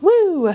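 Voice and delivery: a high playful "Woo!" for a gurgling belly; mock belly woo. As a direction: squeal it like a tummy rumble. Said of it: "my belly's kinda like".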